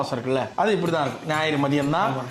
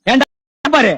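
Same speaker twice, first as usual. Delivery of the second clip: loud exasperated shout; 7 semitones higher, 8 dB louder than usual. Acted. Angry reaction to list of relatives.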